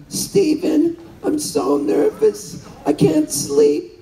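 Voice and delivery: high, slow, fretful mother voice; nervous old mom. Retold or imitated. Aging mother confessing to her son, high and slow, nervous. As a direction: plead like an anxious eighty-eight-year-old mom. Elderly mom asks for pot.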